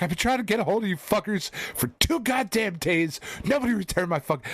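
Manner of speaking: high-pitched, frantic complaint; retold fed-up phone tirade; exasperated rant. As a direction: vent at the receptionist in a high, rushing voice. Retold angry rant at receptionist.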